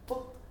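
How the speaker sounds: a short explosive "ポッ" pushed forward from the front teeth; popped consonant. Shown as a demonstration. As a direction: show the choir how to fire the consonant. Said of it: "前にトンと飛ばして".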